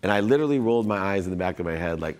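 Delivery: ordinary talk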